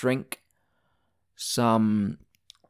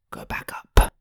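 whisper; 6 dB louder than usual. Real, not acted. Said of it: "hear my anger".

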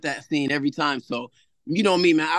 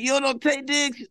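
higher, incredulous question; disbelieving; 11 semitones higher than usual. Retold or imitated. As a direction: act shocked she doesn't know. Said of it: "I'm like".

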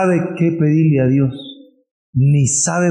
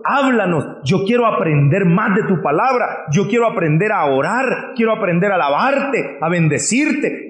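earnest pleading prayer, slightly higher; heartfelt prayer; 4 semitones higher than usual. Acted. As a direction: pray it like you mean it. Models a prayer to God.

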